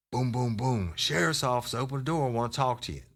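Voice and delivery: re-enacted sheriff demanding an open door; insistent officer call. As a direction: call out again, pitched well up and urgent. Repeats the knock, more insistent.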